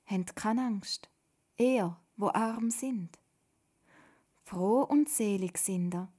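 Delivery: slow, measured proclamation; Jesus preaching. A narrator's reading. Jesus speaking to the hushed crowd, slow and solemn. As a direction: reassure a crowd, each phrase set apart.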